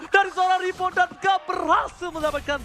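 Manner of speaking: high, loud, driving esports call; loud play-by-play. Real, not acted. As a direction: yell the kills like an announcer. (teriakan komentator)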